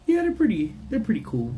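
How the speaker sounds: sudden high squeaky yeah; high squeak. Real, not acted.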